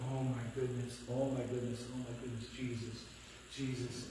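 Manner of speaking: low, soft repeated murmur; murmured panicked prayer. Retold or imitated. Parent praying over fallen child, murmured panic. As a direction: pray it quietly under your breath, retold.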